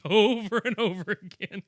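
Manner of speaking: laughing through the words. Real, not acted. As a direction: giggle through it. Laughing at the breathing.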